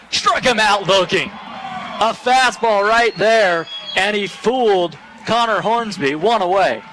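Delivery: energetic live baseball call; excited strikeout call. Real, not acted.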